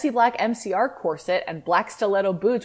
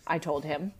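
usual speaking voice

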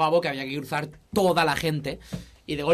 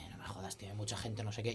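much softer, near whisper, imitating; hushed grumble in a crowded club; hushed mutter; 7 semitones lower, 17 dB quieter than usual. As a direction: mutter it under your breath.

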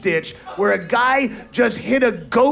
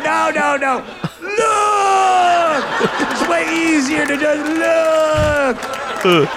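mock shout; 4 semitones higher, much slower than usual. Acted victims and gawker.

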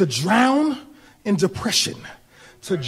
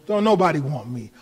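hopeless inner voice; 6 semitones lower than usual. Retold or imitated. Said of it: "we begin to think".

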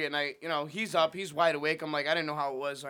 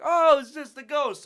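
loud, high, drawn-out imitation; mock casual dismissal; 8 semitones higher, 10 dB louder, much slower than usual. Housemate explains night noises to guest, imitated breezy dismissal. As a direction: slowly wave it off in a raised voice. Retells housemate shrugging off the ghost.

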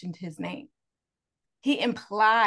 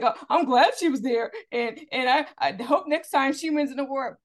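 whiny mock voice of him; mocking impression; 5 semitones higher, 5 dB louder than usual. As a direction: parody his fake-humble tone. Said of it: "he's like".

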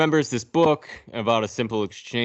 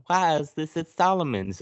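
mock girl voice; 8 semitones higher than usual. Sarah voiced.

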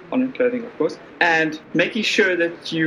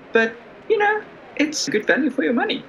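salesy; 10 semitones higher, faster than usual. Acted. GM voices tavern pitch.

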